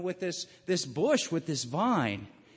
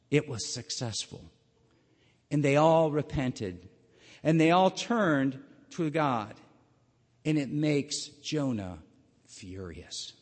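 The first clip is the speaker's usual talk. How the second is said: normal talking voice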